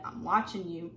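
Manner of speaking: ordinary talk, nothing marked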